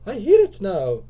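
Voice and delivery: loud, high, drawn-out line; dramatic realisation. Acted. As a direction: announce it high, slow and loud. Lisa after a pause, alarmed.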